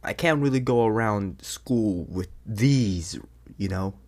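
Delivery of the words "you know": ordinary talk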